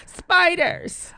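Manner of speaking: high, loud teasing echo; mock him playfully, up high. Teasing the answer.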